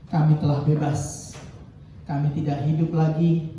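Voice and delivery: low, earnest prayer voice; closing congregational prayer. Acted.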